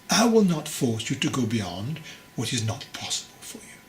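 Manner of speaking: plain reading voice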